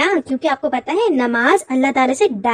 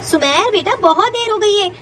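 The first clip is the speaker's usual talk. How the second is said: higher-pitched, insistent summons; urgent calling; 6 semitones higher than usual. Acted. Mother calling son before prayer time ends, urgent. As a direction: hurry a dawdling child along.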